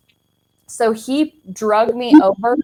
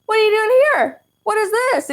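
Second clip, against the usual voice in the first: very high-pitched, incredulous squeal of a question; mock alarmed questioning; 13 semitones higher than usual. Confronting partner over foils under the bed, mock alarmed. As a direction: act out shocked discovery, voice way up.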